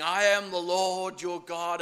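booming, awesome divine declaration; fiery God voice. Acted. God speaking to trembling Abraham, booming and fiery. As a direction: thunder it like God on the mountain. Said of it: "dramatic, fiery, awesome voice".

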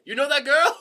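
high-pitched, incredulous question; mock off-camera call. Mill worker to the new boy about a visitor, high mock question. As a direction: toss it out high, acting amazed.

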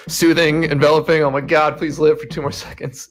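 mock panic. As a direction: play up a frantic healer's plea, half-joking. Joking acted panic during emergency healing.

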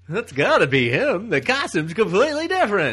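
loud, high mock disbelief; mock outraged shout. Friend mocking comic fans, loud high mock outrage. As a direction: blast it like an outraged fan.

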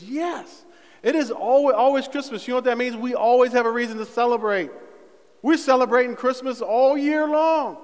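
high, loud, celebratory proclamation; exultant preaching. Real, not acted. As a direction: preach it with rising joy to the whole room. Preacher exulting that Christmas never ends.

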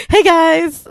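loud, high YouTuber greeting; YouTuber intro. Pretend. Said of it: "I'm gonna do my YouTuber intro".

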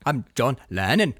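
a hint of Liverpool twang, low and drawn; joking Scouse imitation of a biker; mock Liverpool accent.

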